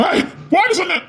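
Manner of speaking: sharp high yell, losing patience; exasperated shouted command; angry shout. Acted. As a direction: bark the order at her. Man losing patience, ordering her.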